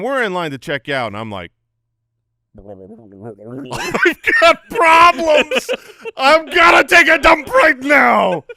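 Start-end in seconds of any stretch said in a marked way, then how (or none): 2.6-8.4 drawn-out high panicked shout; hammed-up panic. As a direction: wail it slowly, mock desperate. Acts out his panicked inner cry.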